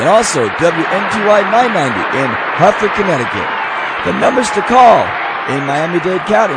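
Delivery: show opening. Acted. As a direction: belt out the radio show welcome to a studio audience. Show host's live opening welcome.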